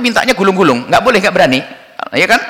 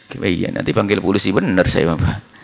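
mock threat, softly; 11 semitones lower, 6 dB quieter than usual. Mother pretends to call police.

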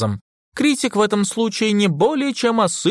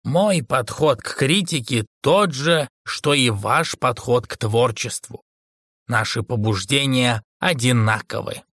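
cool, self-assured critic; 7 semitones lower than usual. A narrator's reading. Fougler explains his approach to Ike, coolly measured.

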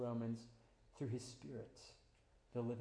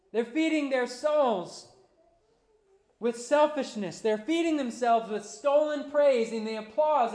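loud, slow, much higher preaching litany; preaching at full force; 10 semitones higher, 14 dB louder than usual. Acted. Pastor rebuking the world's hunger, preaching at full force. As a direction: hammer each line of the list.

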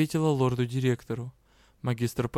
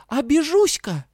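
put-on childish threat; 13 semitones higher, 7 dB louder than usual. A narrator's reading. Heroine invents threat.

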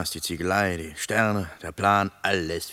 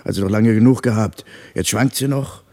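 louder, scoffing pushback at his partner; annoyed retort; 8 dB louder than usual. Acted. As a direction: argue it down, irritated. Quarrel over the compass needle.

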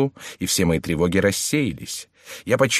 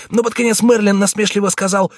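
put-on frightened page boy; 12 semitones higher, 5 dB louder than usual. Narration inside Clarence's voice.

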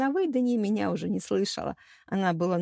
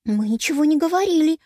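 high, louder squeak of denial; squeaked protest; 7 semitones higher, 5 dB louder than usual. A narrator's reading. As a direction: squeak it out defensively. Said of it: "пискнула Вероника". (пискнуть, оправдываясь)

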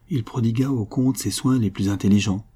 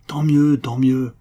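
cries it out, louder and higher; despairing cry; 5 semitones higher, 6 dB louder, slower than usual. A narrator's reading. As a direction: hurl it in despair. Count despairs, struggling. (cri désespéré, plus fort et plus aigu)

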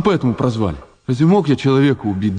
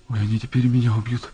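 frightened hush; 7 dB quieter than usual. Acted. Terrified of being killed.

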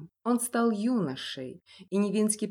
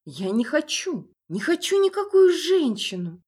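high, loud panicked whining; frightened whimper; 8 semitones higher, 8 dB louder, slower than usual. A narrator's reading. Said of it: "захныкал испуганно Николай".

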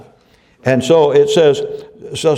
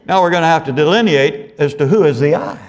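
normal talking voice

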